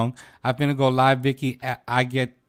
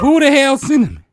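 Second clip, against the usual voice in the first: high, loud incredulous demand; louder incredulous; 13 semitones higher, 9 dB louder than usual. Real, not acted. Host demanding to know who Cinnamon is, loud. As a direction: shout the question way up. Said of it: "repeats with more force".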